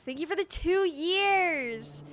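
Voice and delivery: high, slow, sing-song gratitude; drawn-out thanks. Real, not acted. Streamer thanking a subscriber, drawn-out and high. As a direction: stretch every word out warmly.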